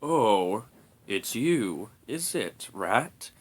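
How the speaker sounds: sleepy, slightly hoarse dragon; lightly hoarse, drowsy murmur. A narrator's reading. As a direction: murmur as if just woken. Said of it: "he murmured with a rather hoarse voice".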